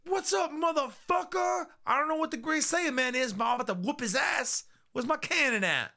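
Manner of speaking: high, hammed-up battle yell; shouting Jocko. Voices Jocko arriving to fight.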